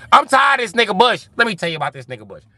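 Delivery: impersonating Cat Williams' outrage; Cat Williams rant. Retold or imitated. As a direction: go off on Bush high and fast.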